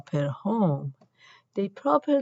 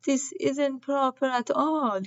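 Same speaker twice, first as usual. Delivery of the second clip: groan; 7 semitones higher than usual. A narrator's reading. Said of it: "groaned Mira".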